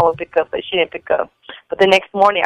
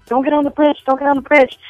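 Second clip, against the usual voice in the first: higher-pitched retold warning from a mom; lightly acted motherly alarm; worried warning; 7 semitones higher than usual. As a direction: urge the kids back, pitched up. Mother warns her kids, retold.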